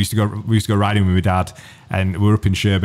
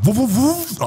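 high startled whoa on the bike; alarmed whoa; 14 semitones higher, slower than usual. Retold or imitated. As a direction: yelp as you realise you're speeding. Braking at speed limit sign.

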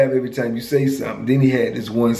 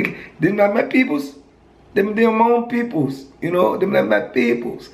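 put-on Virgin Islands accent pitched way up, dismissive; high-pitched Virgin Islands accent; 7 semitones higher than usual. Friend explaining why his people shun him, in a high Virgin Islands accent. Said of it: "I liked his accent".

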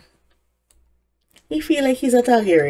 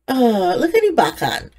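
ordinary talk

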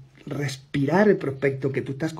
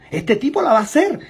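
higher, louder burst of conviction; excited exclamation; 9 semitones higher, 6 dB louder than usual. Retold or imitated. Prospect convinced, exclaiming that the salesman will succeed. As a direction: shout it out, now fully sold. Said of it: "esa persona diga".

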